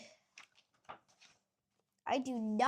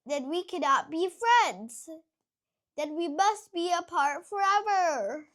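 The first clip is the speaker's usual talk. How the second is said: mock-tragic, louder farewell; melodramatic lament; 3 semitones higher, 7 dB louder than usual. Gerald tells Piggy they must part, mock-tragic. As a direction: ham up the doom of parting. Dramatic farewell between friends.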